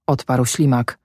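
plain reading voice

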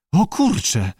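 high, needling inner critic, lightly voiced; cool critical voice. A narrator's reading. Inner voice mocks Abe over Lee's weight, coolly critical. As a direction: needle him, pitch climbing to the question. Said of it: "chłodny i krytyczny głos".

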